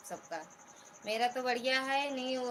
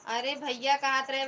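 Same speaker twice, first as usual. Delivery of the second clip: calling out; 4 semitones higher, 7 dB louder than usual. Real, not acted. Calling out to absent brother.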